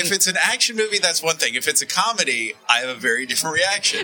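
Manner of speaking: tinny broken mic; tin can phone. Real, not acted. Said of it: "sounds like he's talking through a tin can".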